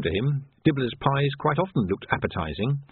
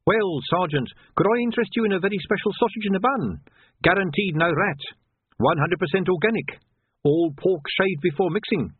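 higher, quick salesman patter; eager street hawker; 5 semitones higher than usual. Acted. Pieman pitching to the sergeant, eager and higher. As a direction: sell the sausage hard and cheerfully.

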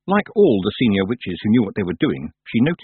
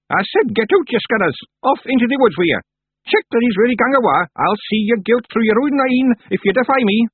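high, loud furious crone; angry old witch; 14 semitones higher, 5 dB louder than usual. Acted. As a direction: bang the stick and roar. Miss Treason shouting them out.

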